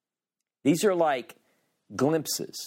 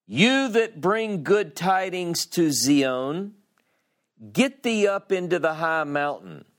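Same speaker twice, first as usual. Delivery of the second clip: proclaiming herald; 5 semitones higher than usual. Acted. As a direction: call it out like a herald from a mountain.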